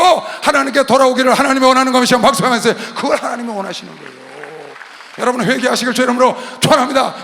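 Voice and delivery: very loud, high proclamation; full-force preaching. Real, not acted. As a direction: call the congregation to repentance at full voice. (큰 소리로 선포하는 설교)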